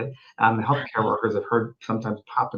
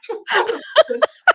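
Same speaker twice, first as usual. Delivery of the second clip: high amused chuckle in character; a giggle in the lady's voice; high laugh. Retold or imitated. Medium acting the spirit's laugh, high chuckle.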